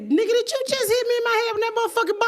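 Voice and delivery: high, fast, loud tirade; outraged confrontation. Retold or imitated. Comic to man who threw Nerf ball, outraged retold yell. Acting out anger at heckler.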